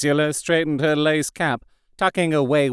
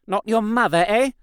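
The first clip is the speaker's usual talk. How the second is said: teasing; 8 semitones higher than usual. A narrator's reading. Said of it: "Ursula teased".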